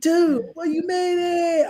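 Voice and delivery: high, drawn-out celebratory shout; countdown cheer. Retold or imitated. Friend celebrating that he survived to New Year's, cheering. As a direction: imitate a jubilant cry, stretching the ending.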